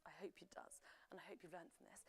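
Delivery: low, whispered afterthought; hushed aside. Real, not acted. Quiz host to players after explaining, hushed. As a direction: drop to a near whisper.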